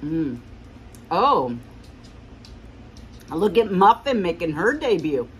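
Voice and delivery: talking with food in mouth; speaking between bites; chewing while talking. Real, not acted. Seen 0: Bite taken at -2; chewing visible through the early labelled words.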